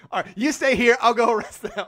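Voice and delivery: hammed-up sheriff giving orders; mock sheriff. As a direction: play the eager cartoon sheriff. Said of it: "He's like".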